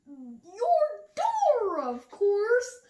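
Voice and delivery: a kangaroo howling at her own joke; howling laugh. A narrator's reading. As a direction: howl the joke out. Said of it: "howled Kangaroo".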